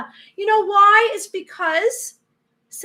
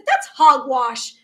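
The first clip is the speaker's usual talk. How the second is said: dismissive, loud; 3 semitones lower, 7 dB louder, slower than usual. Pretend. Whole room calls out together.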